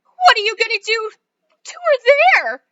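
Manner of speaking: mother cries out in horror; horrified cry. Acted. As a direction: wail it in horror.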